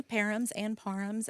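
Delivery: Parhams first as PAIR-ums, then as PAR-ums; first Parhams like "pair", second like "par"; two pronunciations contrasted. Shown as a demonstration. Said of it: "there are so many".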